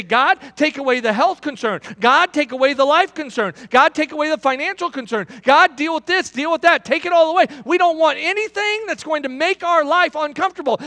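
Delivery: high, loud list of pleas to God; mock prayer. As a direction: beg God to clear every problem away. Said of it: "And so that's how we pray".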